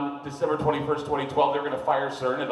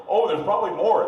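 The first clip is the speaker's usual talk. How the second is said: mock casual officials; 7 semitones higher, 9 dB louder than usual. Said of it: "they say".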